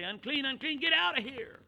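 high, loud cry of alarm; shouted warning. Retold or imitated. Townsfolk chasing a leper away, shouting. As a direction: drive them off with a shout.

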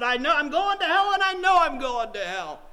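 loud, high, vehement cry of a doomed man; imitated desperate insistence. Preacher quoting a man sure he's damned, loud and desperate. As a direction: belt it out as the despairing man.

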